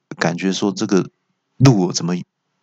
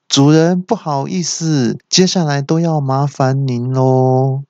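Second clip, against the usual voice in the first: high, slow, deferential navigator voice; higher, drawn-out servile apology; apologetic servant; 5 semitones higher, slower than usual. Acted. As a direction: play a politely apologetic machine servant.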